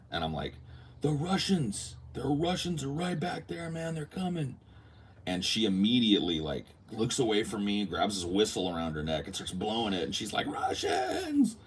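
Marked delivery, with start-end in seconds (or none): 1.0-4.6 high, breathless alarm; urgent warning. Retold or imitated. Telling the police woman the Russians are coming, panicked. 10.5-11.6 very high piercing scream. Retold or imitated. Police woman blowing her whistle, screaming the alarm. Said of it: "blows the whistle and screams".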